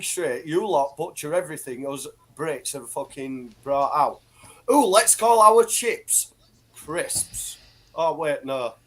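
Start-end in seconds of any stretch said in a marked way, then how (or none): 4.7-7.6 higher, louder, drawn-out mock enthusiasm; put-on silly excitement, dropping away on the last word; mocking imitation. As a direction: mimic them with a high, slow "Ooh". Mocks how others rename British words.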